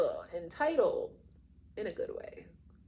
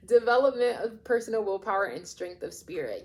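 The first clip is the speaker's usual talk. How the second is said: laughing redo; 3 semitones higher, 7 dB louder than usual. Real, not acted. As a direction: laugh at yourself and read it again. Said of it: "why did I say that like that?".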